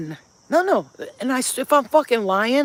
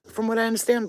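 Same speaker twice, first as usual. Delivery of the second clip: ordinary talk, nothing marked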